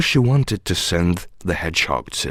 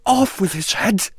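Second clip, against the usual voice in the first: high, imperious cry at no great volume; furious shouting; 10 semitones higher than usual. A narrator's reading. Queen in a passion ordering an execution, furious cry. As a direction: order it in a high royal temper. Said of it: "went stamping about and shouting".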